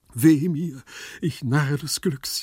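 plaintive lament, slowing at the end; wailing lament. Acted. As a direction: moan theatrically in self-pity. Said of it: "jammerte Gumpellino".

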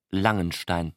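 ordinary delivery, nothing marked

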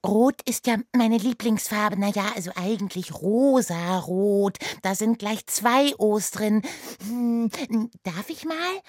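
put-on high, loud chatty girl; bubbly, eager chatter. A narrator's reading. Oda chatters to Rotkäppchen. (hohe, laute, plappernde Mädchenstimme)

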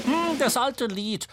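high, knowing remark; hum, then chime in high with amusement; high-pitched merry creature; amused Dommel. A narrator's reading. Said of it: "lachte die Dommel".

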